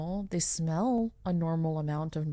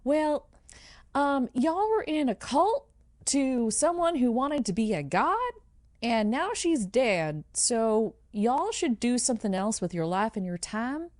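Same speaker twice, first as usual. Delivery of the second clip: higher, lilting public address; melodious voice; 6 semitones higher than usual. Acted. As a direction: gently address the crowd in a raised, melodic pitch. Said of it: "says in her melodious voice".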